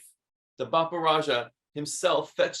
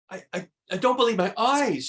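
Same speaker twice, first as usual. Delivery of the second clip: higher, stammering amazement; astonished cry; 8 semitones higher, 4 dB louder than usual. Acted. Miss Rumphius finding lupines over the hill, amazed stammer. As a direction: stumble over the words in wonder. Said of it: "she cried".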